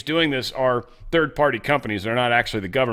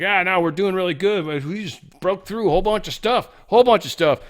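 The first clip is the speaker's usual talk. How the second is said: higher-pitched mock bragging; boastful general; 6 semitones higher than usual. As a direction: puff up fake successes in a high voice. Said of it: "this dude was making up, like, successes".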